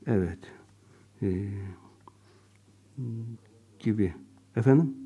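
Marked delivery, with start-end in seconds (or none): none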